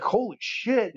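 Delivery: a mock wide-eyed "Holy shit"; excited. Old neighbour seeing the goodies on the table, mock excited. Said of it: "And he's all excited".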